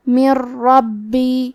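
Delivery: "من ربی" chanted slowly, nun absorbed into a doubled ra; merging without ghunnah. Shown as a demonstration. Teacher demonstrates merging without nasalisation, slow "من ربی" as "mir-rabbi". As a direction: roll straight into a strong ra in "من ربی", no nasal hum. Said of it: "ملا کے بغیر غنہ سے لیکن تشدید".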